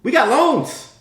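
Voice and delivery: eager counselor. Retold or imitated. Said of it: "the counselor goes".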